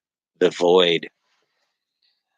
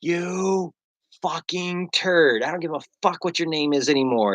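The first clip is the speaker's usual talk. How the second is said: higher, softer, fed-up jeering; mock annoyed follower; 8 semitones higher, 4 dB quieter than usual. Followers annoyed by the cut-off, mock exasperated jeer. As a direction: play a follower cursing at the cliffhanger, half-joking. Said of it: "everyone's just like".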